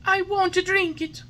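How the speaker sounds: high, loud wailing refusal; crying tantrum. A narrator's reading. Puppet crying as he refuses the medicine. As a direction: bawl it out like a child. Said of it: "bursting out crying".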